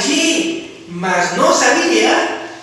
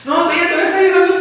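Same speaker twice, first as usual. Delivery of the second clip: excited preaching; 10 semitones higher, 5 dB louder, much faster than usual. Acted.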